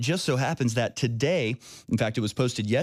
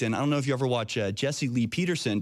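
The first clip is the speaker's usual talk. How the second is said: normal talking voice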